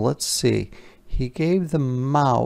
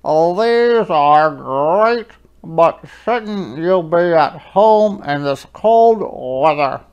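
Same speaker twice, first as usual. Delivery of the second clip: slow, louder puffing otter; 5 semitones higher, 7 dB louder, slower than usual. Acted. Otter greets Hedgehog, slow and puffing. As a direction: huff it out like a cold otter.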